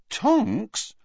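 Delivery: high, loud surprised repeat; surprised echo. A narrator's reading. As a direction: echo the name in disbelief. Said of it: "looking surprised".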